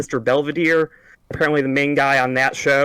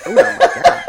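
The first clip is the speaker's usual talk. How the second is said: whispered and squeaking with laughter; cracking up too hard to speak; laughing; 7 dB louder, slower than usual. Real, not acted. Cohost reacting to the ball story, breathless laughing.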